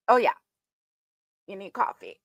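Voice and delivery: ordinary delivery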